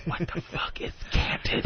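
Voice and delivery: hushed, baffled whisper. Acted. Passenger puzzled by the pilot's word, acted whisper. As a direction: whisper the question under your breath. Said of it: "I'm gonna whisper".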